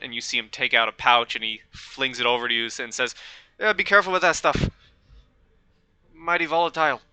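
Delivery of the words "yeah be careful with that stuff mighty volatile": higher, lightly put-on British-Irish accent. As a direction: toss it off in a hint of Irish-British lilt. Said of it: "that's his accent now".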